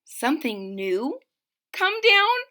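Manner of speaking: shocked question jumping up high on down; gasping in disbelief. Acted. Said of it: "Bartholomew gasped".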